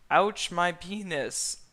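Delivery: loud, slow, high mock scream; hammed-up pained yell. A narrator's reading. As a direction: cry out in pain. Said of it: "Fiona scream".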